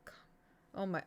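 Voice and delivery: normal talking voice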